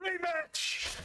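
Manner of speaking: high-pitched battle call; excited challenge. Acted. Game master as a dragonborn tackling an old rival, high excited cry.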